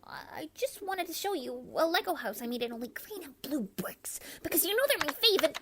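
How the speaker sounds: put-on soft whispered child's voice. Daughter whispering to her father about her Lego house, whisper. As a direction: breathe the words out, barely voiced.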